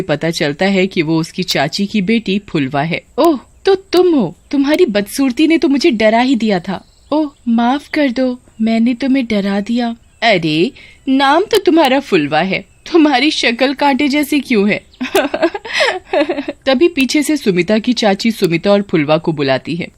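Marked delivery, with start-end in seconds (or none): 3.2-14.8 high-pitched, lightly voiced teasing girl; mildly taunting, higher girlish voice; mocking young cousin. 15.0-16.6 high, teasing giggle; high-pitched acted laughter; mocking laugh. Sumita mocks her cousin's looks.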